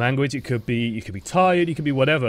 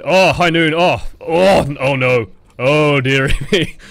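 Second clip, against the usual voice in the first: loud, dismayed exclamations; amused dismay at full volume; alarmed reaction; 7 dB louder, slower than usual. Real, not acted. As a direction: react loudly as the disaster unfolds. Reacting to enemy ultimate in game.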